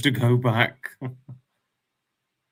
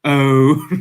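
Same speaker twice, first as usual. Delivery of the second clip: normal talking voice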